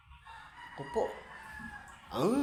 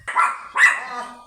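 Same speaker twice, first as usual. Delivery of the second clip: startled yelp; 4 semitones higher, 10 dB louder than usual. Real, not acted. Startled on the motorbike.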